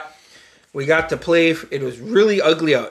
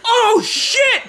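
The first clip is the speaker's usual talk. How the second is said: laughing shocked yell. Retold or imitated. Recalling the car in the puddle, a high laughing yell. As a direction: shout it through laughter.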